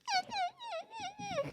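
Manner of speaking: tiny, very high soft whimpers; imitated dog whimper. Retelling the Chihuahua's remorse, high soft whimpering. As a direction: whimper like a guilty little dog. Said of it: "immediately he was like".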